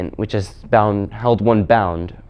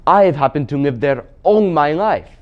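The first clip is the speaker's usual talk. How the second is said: higher, insistent Marshwiggle voice; Puddleglum the Marshwiggle; 5 semitones higher, 4 dB louder than usual. Acted. Marshwiggle telling the Queen he lived there, insistent. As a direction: stand your ground as the old native.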